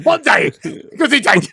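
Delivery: high, shouted fake German accent; hammed-up German accent, mimicking a friend; mock German accent. Imitates the other's put-on accent.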